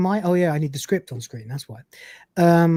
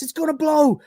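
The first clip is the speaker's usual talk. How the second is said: alarmed shout; 9 semitones higher than usual. Acted. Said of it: "Lister shouts".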